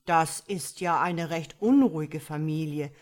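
lightly voiced starling remarking; a bird shaking its head; male starling. A narrator's reading. Starling telling his wife about the frogs, lightly voiced. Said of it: "sagte er".

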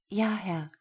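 put-on meek young woman's reply; timid yes. Lena answers her master meekly.

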